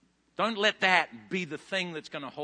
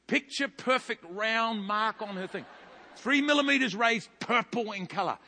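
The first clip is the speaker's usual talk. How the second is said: normal talking voice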